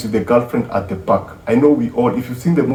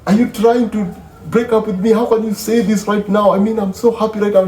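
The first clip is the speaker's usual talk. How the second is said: she can't believe he'd say this; high-pitched hurt girlfriend; voicing the upset girlfriend; 11 semitones higher than usual. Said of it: "She's like".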